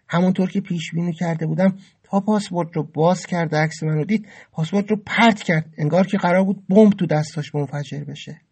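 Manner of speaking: ordinary delivery, nothing marked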